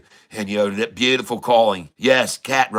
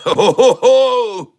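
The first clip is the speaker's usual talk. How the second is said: loud laugh; 12 semitones higher, 7 dB louder, slower than usual. Real, not acted. Bursting laugh at catosphere.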